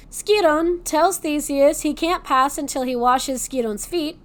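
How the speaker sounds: plain reading voice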